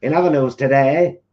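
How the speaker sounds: put-on old newsreel announcer voice; grand wartime newsreader; 1940s newsreader voice. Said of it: "a nineteen forties kind of newsreader voice".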